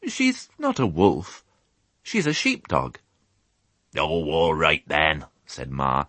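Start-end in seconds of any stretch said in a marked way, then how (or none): 0.1-3.0 high-pitched, mildly wounded protest; a touch of wounded loyalty; rather hurt. A narrator's reading. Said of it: "in a rather hurt voice". 4.0-5.2 slow, grudging rustic ewe; a hint of croaky reluctance; hoarse old ewe. A narrator's reading. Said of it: "the sound of Ma's hoarse tones".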